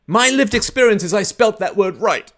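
loud, high, insistent complaint; hammed-up pupil contesting a mark; mock indignant kid. Kid disputes a spelling mark with teacher, mock indignant. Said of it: "you thump the table and you say".

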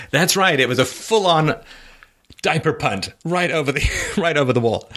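chuckling through the joke; laughing. Real, not acted. Host laughing at the diaper punt headline. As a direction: laugh as you picture it. Joking about the baby punt.

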